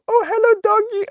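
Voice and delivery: put-on high sing-song "Hello, doggy" like a little kid; sweet child voice. Child greeting a strange dog, high and sweet. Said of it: "And I go".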